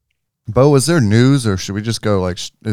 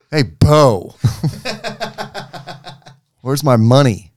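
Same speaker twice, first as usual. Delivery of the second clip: a louder, half-laughing imitation of an angry caller demanding his money; mock angry caller; 6 semitones higher, 6 dB louder, much slower than usual. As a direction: ham up the grumpy voicemail guy. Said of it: "He goes".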